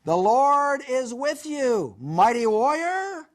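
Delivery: high, drawn-out hail to someone below; calling down. Acted. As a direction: call it out slowly from a height. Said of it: "and shouts down to him".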